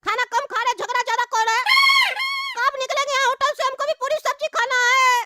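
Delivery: put-on high child's voice, scheming and hungry; a kid talking to himself about food. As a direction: play a greedy little kid plotting. Child character voice again.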